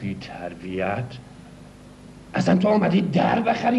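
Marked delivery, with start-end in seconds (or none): 0.0-1.0 low, quiet, slow mock indignation; mock offended. Dealer scolding the rude buyer in low mock indignation.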